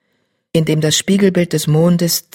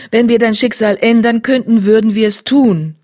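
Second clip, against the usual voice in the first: higher, louder sorry tom; regretful tom voice; 5 semitones higher, 5 dB louder than usual. Acted. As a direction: offer what you cannot.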